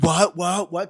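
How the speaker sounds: loud, high mock disbelief; shocked crowd. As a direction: imitate everyone gasping what. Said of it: "And everybody's like".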